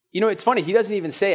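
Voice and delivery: ordinary talk, nothing marked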